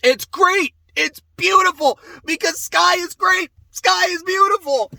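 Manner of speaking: high, excited gushing praise. Real, not acted. Fan praising the game with high excited gushing. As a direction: gush about it like a fan.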